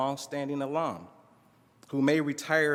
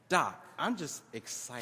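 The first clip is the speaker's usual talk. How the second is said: a softer, heavy-toned reply; 5 dB quieter than usual. A narrator's reading. Student turning serious with his professor, heavy tone. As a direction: let the words sit heavy. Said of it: "Kenny asked with a heavy tone in his voice".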